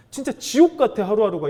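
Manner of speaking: put-on a teenager crying out that every day is hell; desperate teen. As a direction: let the despair burst out. Teen child pleads to father.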